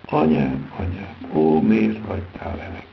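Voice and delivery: loud, anguished lament; pained cry. A narrator's reading. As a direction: cry out in pain to a mother. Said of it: "fájdalmasan feljajdul".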